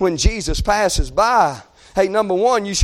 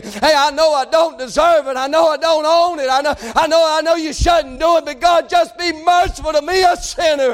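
pleading prayer; 5 semitones higher than usual. Shown as a demonstration. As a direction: cry for mercy at full force. Preacher models a loud prayer.

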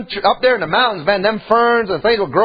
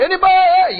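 high-pitched searching holler; calling out; 9 semitones higher than usual. Acted. Lost boy seeking anyone nearby, calling out. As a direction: call high into the distance for help. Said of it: "We started yelling".

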